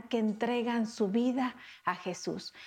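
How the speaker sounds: normal talking voice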